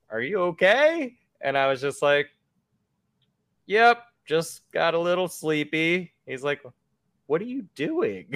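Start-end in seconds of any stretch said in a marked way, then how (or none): 0.1-1.2 high-pitched questioning officer impression; retold concern, pitched well up; concerned police officer. Voices the police officer checking him. 3.7-6.1 slow, higher-pitched innocent reply; retold awkward innocence, dragged out; sheepish downplaying. Plays himself downplaying to officer. 7.3-8.3 high, incredulous officer question; mock questioning, pitched way up; puzzled police officer.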